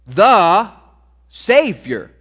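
"The" punched loud, then "Savior" held; emphasis on Savior. Shown as a demonstration. Preacher showing congregation the key word, stressed. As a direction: hit "The Savior" hard and separate. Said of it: "Emphasize the word Savior".

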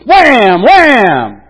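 loud mock "Wham!" as the staff hits; loud smacking "Wham!" for the staff blow; striking sound. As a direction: bang it out like striking the rock. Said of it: "And Moses goes".

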